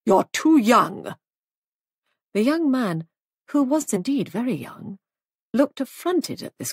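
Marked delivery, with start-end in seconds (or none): 0.1-1.2 slow, louder disapproval; disapproving. A narrator's reading. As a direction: pronounce it as a slow verdict. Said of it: "she said disapprovingly".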